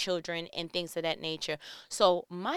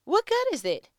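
high, loud, pleading repeat; raised emphatic question; 12 semitones higher, 7 dB louder than usual. Real, not acted. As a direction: push the question up and out. Repeated question, pushed higher.

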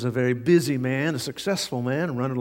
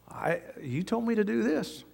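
higher-pitched, softly aggrieved objection; mock protest, pitched up; retold protest; 8 semitones higher, 4 dB quieter than usual. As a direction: act out a flustered assistant objecting. Assistant protests to upset boss.

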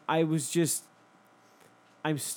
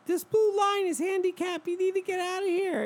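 much higher put-on voice; squeaky lady. The lady ordering the driver, squeaky. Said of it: "her voice was very like this".

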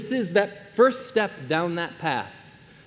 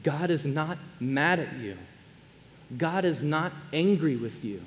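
soft, gentle, much quieter voice; tender Jesus; 4 semitones lower, 8 dB quieter than usual. Acted. Jesus forgiving the ashamed man, soft and tender. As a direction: speak it softly, like a loving father to a child. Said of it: "when Jesus looks at this man and says".

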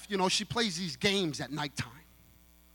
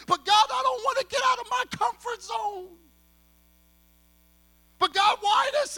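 loud, high complaining plea; whiny protest; 7 dB louder than usual. Acted. Believer balking at God's call, loud whining protest. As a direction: shout your reluctance at God.

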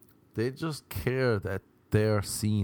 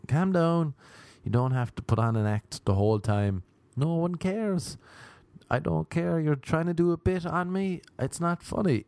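mock exasperation; 7 semitones higher than usual. Acted telling-off of tiresome comedian.

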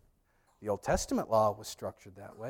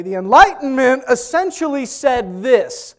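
high, loud, slow lecturer's proclamation; hammed-up professor declaiming at the class; imitated seminary lecturer; 11 semitones higher, 14 dB louder, slower than usual. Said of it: "And he would say".

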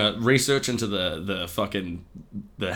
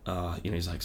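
normal talking voice

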